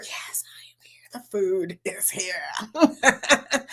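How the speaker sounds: hushed aside to the chat; a whispered morning aside; playful whisper. Real, not acted. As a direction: whisper it like a secret.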